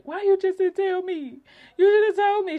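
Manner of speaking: high, loud pleading complaint; upset younger self. Retold or imitated. Telling her sister she should have told her, high and loud. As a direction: demand it through tears. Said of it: "And I was like".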